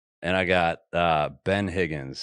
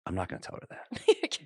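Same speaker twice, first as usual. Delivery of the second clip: refusing quietly; 7 dB quieter than usual. Retold or imitated. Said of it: "And I'm like".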